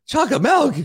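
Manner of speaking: high, mocking disbelief; incredulous buddy.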